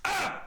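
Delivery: short, high-pitched yelp; sudden scream. Acted. Someone heard from below deck, sudden high scream. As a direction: let out a quick, high cry. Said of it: "you just hear a".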